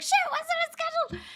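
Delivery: startled quote. Retold or imitated. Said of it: "you jumped up and you're like".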